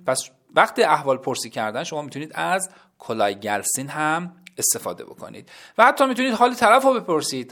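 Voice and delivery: usual speaking voice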